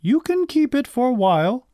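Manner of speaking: high-pitched storybook mother; high character voice for a mother; mother's voice. A narrator's reading. Mother's closing dialogue in story.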